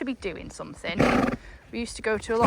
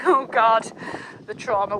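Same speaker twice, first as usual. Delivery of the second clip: usual speaking voice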